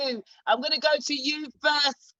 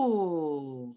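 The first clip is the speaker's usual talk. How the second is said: drawn-out "ooh"; 8 semitones lower, 5 dB quieter than usual. Real, not acted. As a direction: react with a big, stretched-out ooh of surprise. Scandalised reaction to a surprise verdict.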